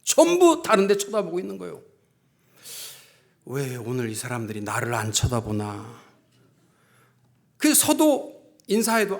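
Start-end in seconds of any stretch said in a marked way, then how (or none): 3.5-6.0 low-pitched wondering to oneself; puzzled musing. Retold or imitated. Pastor wonders why nobody looks at him, low puzzled musing. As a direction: ponder it in a lowered voice. Retold puzzled thought at the pulpit.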